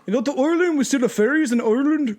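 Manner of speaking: mock Irish accent, half-hearted; bad Irish accent. Joking to Irish fans, clumsy Irish accent. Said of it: "I can't do an Irish accent".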